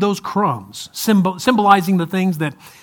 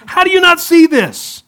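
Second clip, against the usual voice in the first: high, loud incredulous outburst; pitched-up, exasperated how-can-you-not; exasperated disbelief; 11 semitones higher, 6 dB louder than usual. Retold or imitated. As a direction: throw up your hands in disbelief. Mocking others' obvious faults.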